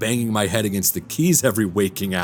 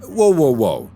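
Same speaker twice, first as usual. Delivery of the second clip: higher, louder burst of surprise; startled interruption; 3 semitones higher, 5 dB louder than usual. Acted. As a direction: cut in, suddenly taken aback. Startled interruption after hearing of himself.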